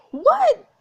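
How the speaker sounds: high, loud, shocked yelp; loud one-word squawk of disbelief; outraged disbelief. Real, not acted. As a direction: shoot up in pitch, appalled. Player outraged at the insult.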